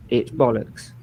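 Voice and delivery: usual speaking voice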